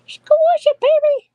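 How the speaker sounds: high, loud gushing squeal; overwhelmed by cuteness. Real, not acted. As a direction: squeal it out over something adorable. Gushing over the doll.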